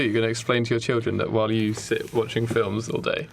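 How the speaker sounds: normal talking voice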